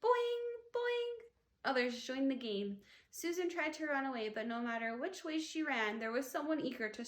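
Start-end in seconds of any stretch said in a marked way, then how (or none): 0.0-1.1 high, springy boing boing; teasing boings. A narrator's reading. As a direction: bounce each boing up high. Teasing game.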